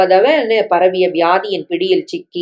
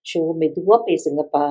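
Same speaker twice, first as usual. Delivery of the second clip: hushed; 2 semitones lower, 5 dB quieter than usual. A narrator's reading. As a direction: hush them quietly in a hospital. Hushing sons in hospital. (மருத்துவமனையில் மகன்களை மெதுவாக அடக்கும் குரல்)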